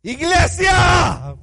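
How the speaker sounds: high, slow shout, each name drawn out; loud cry. Acted. Jesus calls Lazarus and the church out of the grave, slow and shouting. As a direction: call him out of the tomb at full voice. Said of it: "clamó a gran voz".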